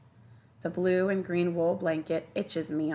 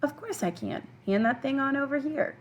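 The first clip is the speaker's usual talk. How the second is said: higher, hearty agreement; cheering; 8 semitones higher than usual. A narrator's reading. Old man happily takes the boy's film, cheering. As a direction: agree gladly in a raised pitch. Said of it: "the old man cheers".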